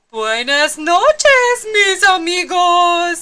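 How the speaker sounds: high, slow, loud kitty voice; drawn-out cheerful goodbye; sleepy goodnight. Acted. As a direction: bid your friends goodnight. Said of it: "said Skippy John Jones".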